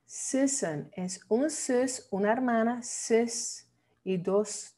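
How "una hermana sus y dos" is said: ordinary talk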